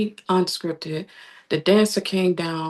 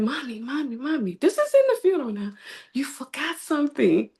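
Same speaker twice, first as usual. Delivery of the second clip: put-on child voice; 7 semitones higher than usual. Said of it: "she said".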